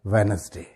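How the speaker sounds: "وینسڈے" as wen-es-day, first D silent; correct pronunciation. Shown as a demonstration. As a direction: run "وینسڈے" together without the middle D. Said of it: "اس کا صحیح pronunciation کیا ہوگا؟". ("وینسڈے" بغیر پہلی ڈی کے)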